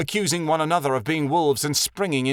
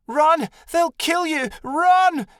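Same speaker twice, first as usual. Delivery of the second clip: very high frantic yell; 6 dB louder, much slower than usual. A narrator's reading. Scene: Jade begging her brother to run, yelling. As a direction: yell it with everything. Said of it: "Jade yelled again".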